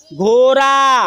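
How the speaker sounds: slow, long-held vowels; drawn-out modelling. Shown as a demonstration. Teacher models an animal name for children to repeat, drawn out. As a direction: draw the word out for repeating.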